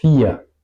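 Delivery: "vier" opened with an f; V alemana como F. Shown as a demonstration. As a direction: say "vier" as "fia". ("vier" con la V como una F)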